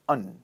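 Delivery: AND weakened to "un"; low, unstressed "un" for AND. Shown as a demonstration. Teacher models unstressed and for learners, a low "un". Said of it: "unstressed. So we pronounce it as".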